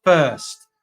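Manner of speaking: first said loud and stretched, falling at the end; first punched out loud; stress on first. Shown as a demonstration. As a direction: lean hard on the word first. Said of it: "I emphasize the word".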